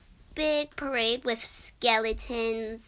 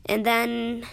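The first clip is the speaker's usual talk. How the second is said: ordinary talk, nothing marked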